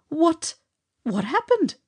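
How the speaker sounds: dazed, high, loud demand for an answer. Acted. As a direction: stammer out a shocked demand. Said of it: "I demanded of Charlie".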